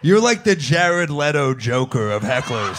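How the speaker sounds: loud, drawn-out, emphatic put-down; heckler put-down. Real, not acted. Comic to a heckler at the show, loud slow put-down. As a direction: raise the voice and stretch out each insult.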